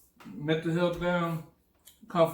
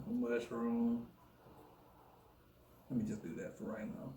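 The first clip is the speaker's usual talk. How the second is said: whispering; 6 semitones lower, 12 dB quieter than usual. Real, not acted. Whispering over food.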